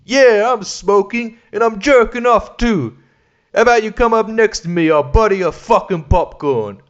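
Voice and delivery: strut through it like a cocky comic; Dice impression. Comic in cinema taunting a neighbour, brash and high. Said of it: "that Dice joke".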